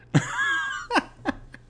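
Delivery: high airy burst of laughter; laughing. Real, not acted. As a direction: laugh at your own joke. Laughs at own joke.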